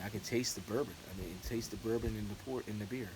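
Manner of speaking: normal talking voice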